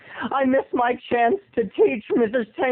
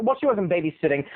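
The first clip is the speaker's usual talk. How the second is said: ordinary delivery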